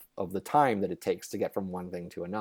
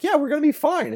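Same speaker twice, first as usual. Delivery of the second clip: much higher, breezy reassurance; cheery brush-off; 6 dB louder than usual. Retold or imitated. Friends reassure the worried UK friend, breezy and much higher. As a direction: laugh off his worry. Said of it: "And we were like".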